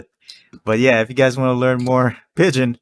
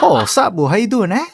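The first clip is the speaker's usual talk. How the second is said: higher, laughing island greeting; Hawaiian Pidgin greeting; 9 semitones higher than usual. Retold or imitated. Greeting a friend in Hawaiian Pidgin, higher and laughing. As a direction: greet a buddy Pidgin-style. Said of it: "Be like".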